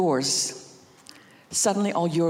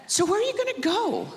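high, bright playing-along voice; sweet mom question; 12 semitones higher than usual. Mother humouring her runaway son, high and sweet. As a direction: humour the little adventurer.

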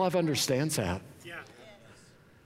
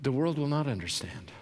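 ordinary talk

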